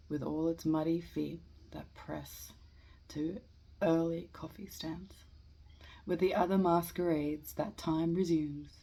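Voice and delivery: measured verse reading with pauses between lines; poetry reading. Acted. Amateur reading Eliot's Preludes to listeners, measured poetry reading. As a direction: read each line out deliberately, then pause. Reads a poem aloud.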